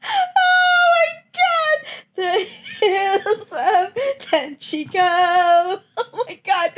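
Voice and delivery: high, drawn-out laughing wail; overcome with laughter. Real, not acted. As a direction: wail it out through helpless laughter. Overcome by laughter, sings lyric.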